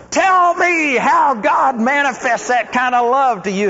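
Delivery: loud, high, slow desperate plea; pleading woman acted out mid-sermon. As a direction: beg like a woman grabbing you by the arm. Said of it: "like this desperate woman".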